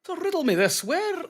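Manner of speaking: mock riddler. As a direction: tease like a riddling villain. Quoting a riddler line.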